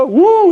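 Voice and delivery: high, loud fake crying howl; mock childish wail. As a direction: wail like a drunk man regressed to a child. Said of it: "애처럼 울면서". (애처럼 우는 시늉)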